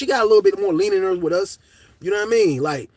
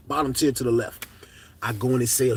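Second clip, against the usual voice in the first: ordinary talk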